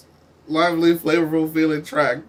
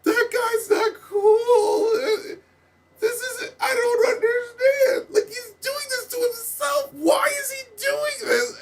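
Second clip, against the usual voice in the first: much higher, whiny, near-crying rant; exasperated disbelief; slower than usual. Real, not acted. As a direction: cry out in comic disbelief at the track. Ranting in exaggerated disbelief.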